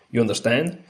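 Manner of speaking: usual speaking voice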